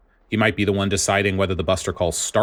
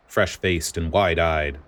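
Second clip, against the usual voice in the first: ordinary delivery, nothing marked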